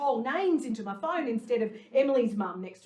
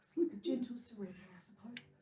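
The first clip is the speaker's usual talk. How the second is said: dreamy whisper; 3 semitones lower, 9 dB quieter than usual. Real, not acted. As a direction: breathe it out as a whisper. Whispered dreamy moment in water.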